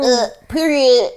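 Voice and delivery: drawn-out mock city-girl American accent; bad American accent. Host mimicking city girls over objections, bad American accent. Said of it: "That's how they all talk".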